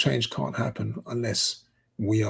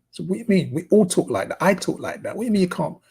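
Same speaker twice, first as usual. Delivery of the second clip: raised, higher-pitched indignant protest; retold indignation; 8 semitones higher, 5 dB louder, faster than usual. Presenter objecting to bosses who binned his tape, retold indignation. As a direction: protest loudly, as the offended presenter would. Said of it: "Hurdle was so peed off".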